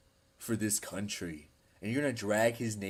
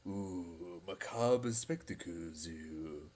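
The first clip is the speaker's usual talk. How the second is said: mustache twirling villain; 4 semitones lower, 5 dB quieter, slower than usual. Retold or imitated. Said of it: "You're, you're twirling the mustache".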